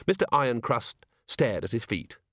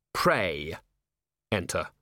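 low, separated words with a pause; sinister echo, as if 'prey'; ominous; 5 semitones lower, slower than usual. A narrator's reading. Commander rehears the invitation as a threat, low and ominous. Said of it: "the words respelled themselves in Vimes's hindbrain as".

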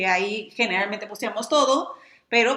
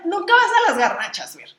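burla acusadora; 7 semitones higher, 6 dB louder, faster than usual. Real, not acted. Lo acusa en broma.

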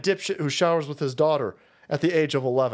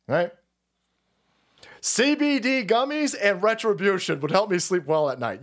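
usual speaking voice

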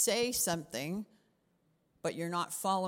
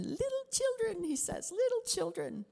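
tender. Retold or imitated. John tenderly addressing believers.

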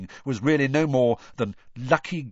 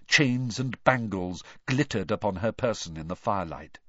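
plain reading voice

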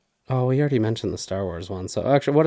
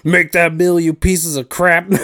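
high, loud mock chef rant; mock Gordon Ramsay yell; 8 semitones higher, 10 dB louder, slower than usual. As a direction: bark it like an angry TV chef. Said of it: "and he's like".

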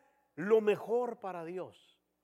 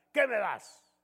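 mock insolent yell; 3 semitones higher, 4 dB louder, slower than usual. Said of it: "el hijo le grita en la cara".

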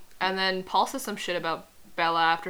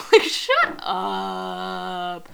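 very drawn-out, loud scoff; scornful dismissal; 6 dB louder, much slower than usual. Real, not acted. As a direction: drag out the last word in disdain. Scoffs at a character's line.

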